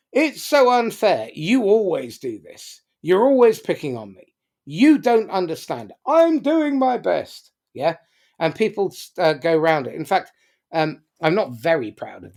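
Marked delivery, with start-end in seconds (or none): 0.1-1.3 higher, louder quick "so unfair"; mock complaint. Speaker voicing a quarrel, second take, louder. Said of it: "people just swap positions". 1.3-7.5 higher, louder mock quarrel, victim and accuser; quarreling drama triangle voices. Speaker acting out people swapping roles in a fight, whiny and loud. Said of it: "people just swap positions".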